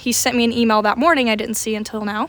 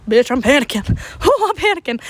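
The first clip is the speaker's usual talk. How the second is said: acted panic. Student panicking in the classroom, mock tearful panic. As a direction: act out a tearful freak-out. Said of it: "I start crying".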